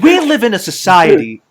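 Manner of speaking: loud, high mock-grand meme line; hammed-up meme line. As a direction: belt the meme line out like a joke.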